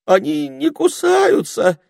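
very high slow scared voice; trembling. A narrator's reading. Ermine fearing the children, trembling. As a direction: ask with a trembling voice. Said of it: "дрожащим голосом осведомился горностай".

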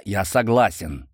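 usual speaking voice

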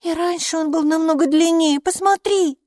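put-on high, loud little-girl voice; insistent child. A narrator's reading. Майки shows the gatekeeper the root, high insistent child voice. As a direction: urge them to look, high and childlike. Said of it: "продолжала Майки".

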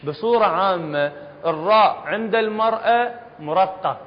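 usual speaking voice

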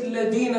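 "الذين" with a hard d, "alladina"; dhal as dal. Shown as a demonstration. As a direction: harden the th of الذين to a d. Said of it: "هناك من ينطقها دالا خالصا".

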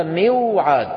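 "موعد" slow as miw-'aad; original unaltered form. Shown as a demonstration. Teacher repeats the origin to students, "موعد" slow as miw-'aad. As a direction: sound a long waw after kasra in "موعد". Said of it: "أصلها موعد".

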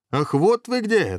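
high, drawn-out exclamation; dragon head's cry. A narrator's reading. Dragon head finding the wedding party, high crying out. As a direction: pounce on them with a long high cry. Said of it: "закричала голова Иван".

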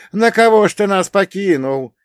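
loud, high keening lament; old woman's lament. Acted. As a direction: wail it like an old peasant woman. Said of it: "прочитала какая-то старуха". (старушечье причитание)

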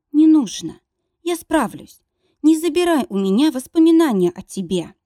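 high pleading through tears; tearful pleading. Acted. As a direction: plead with him, close to crying. Heroine pleads, crying.

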